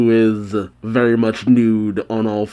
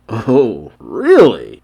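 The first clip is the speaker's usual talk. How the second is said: surprised; 5 dB louder, much slower than usual. Real, not acted. Surprised at the blush scene.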